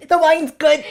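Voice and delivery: high, loud, strained choke-talk; choking voice. Retold or imitated. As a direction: squeeze it out mid-choke, insisting. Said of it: "after you're choking".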